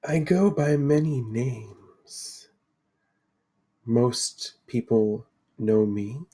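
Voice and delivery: slow, measured mystic seer; mysterious Lady of the Lake. Acted. Lady introduces herself to four adventurers, solemnly mysterious. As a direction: unfold it slowly, with weighty pauses. Game master voices the Lady.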